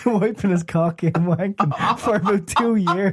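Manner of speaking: slow, high, helpless laughing delivery; laughing while talking. Real, not acted. Friend reaching the filthy punchline about a T-shirt, cracking up. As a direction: squeeze the words out through real laughter.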